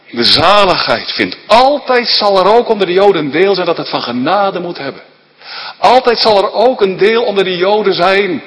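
luid en hoger, preken op volle kracht; vurig preken. Acted. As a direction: preek het vurig de zaal in. Preacher at full force. (vurige preekstem)

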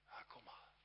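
hushed invitation, barely voiced; gentle whisper. Jesus gently inviting the guilty sinner, whispered. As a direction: whisper it like a tender call to come.

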